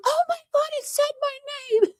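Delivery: high, excited mock squeal. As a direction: squeal it like a thrilled fan. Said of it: "He was like".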